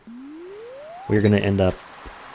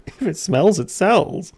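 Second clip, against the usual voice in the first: mocking echo; 11 semitones higher, slower than usual. Gamer echoing Willy's odd motto, mock incredulous and high. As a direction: repeat the saying much higher, incredulous.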